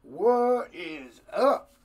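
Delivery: ordinary talk, nothing marked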